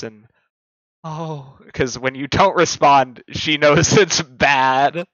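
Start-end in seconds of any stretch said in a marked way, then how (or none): 1.0-1.6 quiet worried oh. Acted. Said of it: "you can hear in her voice".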